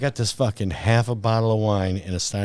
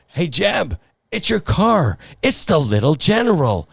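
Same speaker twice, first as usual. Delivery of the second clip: higher, eager shout across to a buddy; excited call; 8 semitones higher than usual. Retold or imitated. Trooper calling to his buddy Jeb, excited and high. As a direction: call out like you recognise a celebrity.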